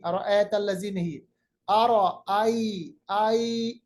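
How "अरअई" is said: अरअई slow, ai drawn soft; soft drawn vowel. Shown as a demonstration. Teacher models अरअई slowly. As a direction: stretch the ai of अरअई gently. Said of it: "ऐ नहीं".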